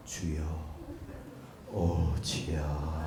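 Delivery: very slow, soft, low sigh; limp prayer. Shown as a demonstration. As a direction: drag it out lifelessly. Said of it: "그게 아니라니까".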